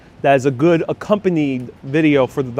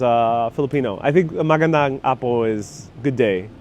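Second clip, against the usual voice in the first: ordinary talk, nothing marked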